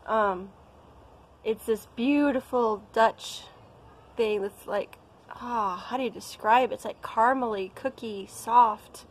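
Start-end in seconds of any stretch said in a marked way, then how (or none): none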